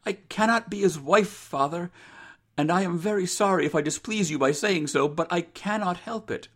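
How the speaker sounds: high, earnest daughter's voice; apologetic daughter. Acted. Rose apologises to her father, high and firm.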